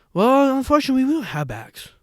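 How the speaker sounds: high put-on clerk voice, dropping at the end; imitated shop girl. Imitating shop girl refusing a bag.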